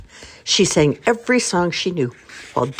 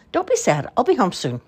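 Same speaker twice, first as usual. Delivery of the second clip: put-on high child voice; 7 semitones higher than usual. Child comforts parents.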